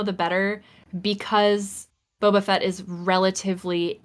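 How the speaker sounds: ordinary talk, nothing marked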